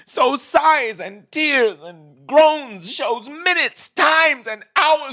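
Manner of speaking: high, crying, slow words; sobbing grief. Acted. Imprisoned king cries out over his stolen time, sobbing. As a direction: sob it out, rising to a cry.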